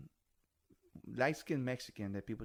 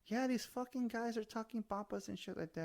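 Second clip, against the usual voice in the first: whiny complaint; 12 semitones higher than usual. Retold or imitated. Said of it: "I'm like".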